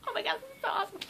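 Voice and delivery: tiny, very high, hushed squeal; starstruck squeal. Retold or imitated. Fan beside her idols' mom, soft high starstruck squeal. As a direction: squeal softly in fan awe. Retold awestruck fan moment.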